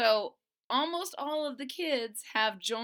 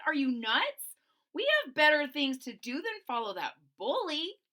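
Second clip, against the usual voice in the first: louder, higher scolding; indignant Boland; 5 semitones higher, 5 dB louder than usual. Acted. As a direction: argue hotly with your friend. Boland argues with friends.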